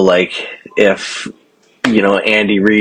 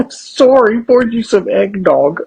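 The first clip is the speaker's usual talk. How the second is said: high, slower Canadian sorry; mock Canadian; 9 semitones higher, slower than usual. Mocking Canadian host, high sorry with a laugh. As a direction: join the Canadian bit.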